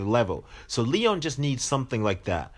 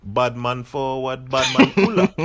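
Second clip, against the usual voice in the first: loud mock Jamaican patois chant; hammed-up Yardie accent, rising louder; 3 semitones higher, 7 dB louder than usual. Host joking how Leon should say it, Yardie accent. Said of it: "In a Yardie accent".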